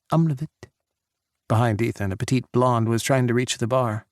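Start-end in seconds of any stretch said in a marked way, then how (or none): 0.1-0.7 Czech phrase. Acted. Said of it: "She spoke again in Czech".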